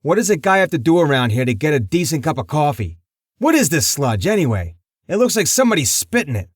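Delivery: a grumpy mob boss griping; irritated tough guy; gruff complaining boss. Acted.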